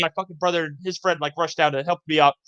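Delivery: ordinary delivery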